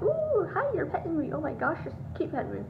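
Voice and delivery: high squealed greeting that settles down; playful, thrilled pet voice, starting very high; excited dog voice. Acted. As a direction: voice a jumpy dog begging for petting. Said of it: "she's all jumpy".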